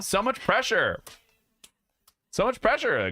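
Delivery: high teasing copy of her sigh; mocking imitation. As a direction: parrot her line in a whiny high pitch. Mocking wife's last words.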